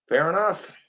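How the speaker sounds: usual speaking voice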